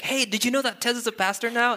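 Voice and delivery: high-pitched imitation of an eager friend; excited friend. Friend announcing the pastor to a newcomer, imitated excitement. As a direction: call out the big news, imitating him. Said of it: "they're actually really excited that I'm a pastor".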